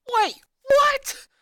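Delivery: high, loud shriek of shock; shocked yell. A narrator's reading. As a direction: shriek it in disbelief. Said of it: "Lola yelled".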